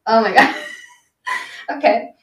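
ordinary delivery

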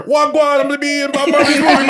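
exaggerated Jamaican patois voice; mock Jamaican accent. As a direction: tease with a big Jamaican accent. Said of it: "supposed to be in that new [name] movie".

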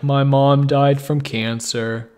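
slow, depressed mock storyteller; Eeyore. Host mocking his co-host's depressing story voice, gloomy drawn-out impression. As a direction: drag it out glumly, teasing a gloomy friend. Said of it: "going for the Eeyore impression".

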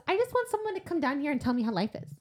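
high, quick monster character voice; comic high-pitched monster; put-on monster voice. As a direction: play the monster as a chatty, curious creature. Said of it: "I love your accent for him".

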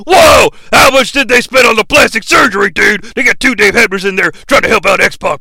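loud, fast put-on voice; amazed shouting character. Wrestler amazed at two Dave Hebners, loud rapid rant. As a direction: burst out shouting, then race on.

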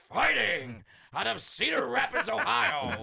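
hyped ring announcer intro. Retold or imitated. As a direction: call it out like a fight announcer. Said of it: "Buffer's right in your face".

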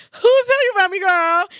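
high-pitched, incredulous question; playfully stunned, voice shooting up; astonished retelling. Retold or imitated. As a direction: act out being amazed she knows of you. Retelling her amazed reply.

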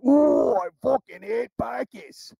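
drawn-out high movie-tough impersonation; Gorgeous George. Retold or imitated. Quoting a movie character who hates pikeys, imitated accent. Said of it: "that's the way this guy talked".

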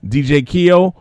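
ordinary talk, nothing marked

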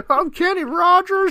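a squeaky gag line; goofy joke voice. Pretend. Joking along with the glitch story, high silly voice. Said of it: "S0 jokes in a voice".

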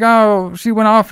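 mock little kid.

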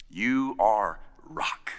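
slow, low and weighty; Jesus solemnly naming Peter the rock. Acted. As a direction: lay each word down deliberately.

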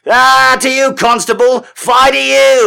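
put-on high, loud old-timey jeer; defiant scallywag. Drunken scallywag taunting a constable, high defiant shout. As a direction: shout it at the law in mock defiance. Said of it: "And I'm like".

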